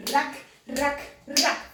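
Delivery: RACK as short, separate accent hits; musical accents. Shown as a demonstration. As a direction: punch each RACK like a beat in the music. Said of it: "like accents in the music".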